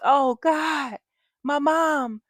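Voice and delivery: high, loud, overdone gasp of grief; mock dramatic dismay. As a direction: ham up a shocked friend's reaction. Imitating people reacting to mom's death.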